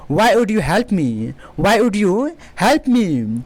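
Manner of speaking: very slow model question; slow classroom demo; slow teaching delivery. Shown as a demonstration. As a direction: say it slowly for learners. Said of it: "teacher models question".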